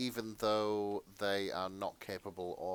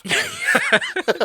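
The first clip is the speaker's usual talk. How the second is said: laughing; 13 dB louder than usual. Real, not acted. Laughing while telling game story.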